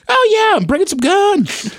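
very high, breezy mock-casual. Mocking someone casually declaring guns at the Canadian border, very high and breezy. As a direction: chirp it like it's nothing. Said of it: "being that casual".

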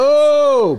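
high, loud drawn-out oh; shocked reaction. Real, not acted. Host hearing guest was stuck in an elevator, shocked. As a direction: react aghast, pitch shooting up.

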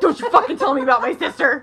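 hammed-up protective brother; laughing mock-furious scolding; mock outraged brother. Brother's outraged retort retold.

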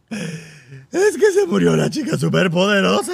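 berrido lento y fuerte, imitando llanto; llanto imitado. Retold or imitated. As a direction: imita a un niño desconsolado, a gritos. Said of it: "cuando se pudo calmar". (lloriqueo exagerado y arrastrado)

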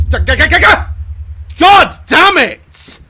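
loud high stammering shout; startled stutter. Real, not acted. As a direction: stumble over it in fright. Jump scare stutter.

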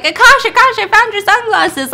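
put-on high, loud eager kid voice; kid calling out. Little kid returning the blind man's sunglasses, calling out. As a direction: holler it like a pestering child.

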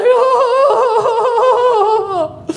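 high, wailing mock sob; mock crying oh. Stripper breaking down in tears, mock wail. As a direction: imitate her bursting into tears. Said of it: "She starts crying".

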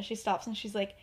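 ordinary talk, nothing marked

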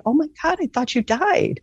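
stunned, voice leaping up high; shocked disbelief. Retold or imitated. To her grandmother's spirit, shocked she's alive. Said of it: "and I'm just shocked".

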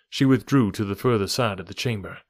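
ordinary delivery

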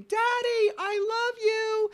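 put-on high, loud little girl calling out; imitated child's yell. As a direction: call out slowly like a daughter to Dad. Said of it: "my daughter's yelling".

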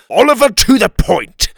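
high, very loud interruption; booming, impatient king; impatient command. A narrator's reading. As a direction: cut in sharply and order him on. King cuts off rambling Oliver.

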